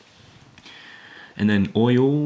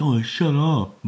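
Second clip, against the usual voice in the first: higher-pitched, irritated put-on snap; mock a peeved fighter's comeback; pissy retort; 6 semitones higher, 4 dB louder, slower than usual. Granola snapping back at Vegeta mid-fight, pissy. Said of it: "Granola gets all pissy".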